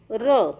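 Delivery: र as an isolated "ruh"; phonic R sound. Shown as a demonstration. Teacher showing the sound of R, र as "ruh". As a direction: sound out र, not the letter name. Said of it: "आरचा उच्चार होतो".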